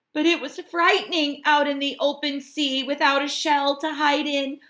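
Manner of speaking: high, fast, fretful worry; a little crab scared in the open sea; worried Hermit Crab. A narrator's reading. Crab alone in the open sea, fretting high and fast. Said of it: "he thought".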